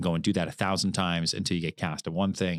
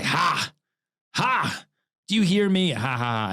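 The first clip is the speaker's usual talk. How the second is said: high, mocking drunk laughter and jeer; pitched-up taunting Stanley cackle; Stanley's taunting laugh; 9 semitones higher, 4 dB louder than usual. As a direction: jeer at her with forced stage laughs. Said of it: "I say".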